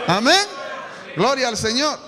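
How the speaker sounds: loud shout of praise; shouted amen. Real, not acted. Pastor rousing the congregation with a shouted amen. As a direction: call it out to the church.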